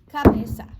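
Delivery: \"cabeza\" shouted hard as the ball is headed; effortful shout. Real, not acted. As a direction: bark it out while heading the ball. Heading the ball.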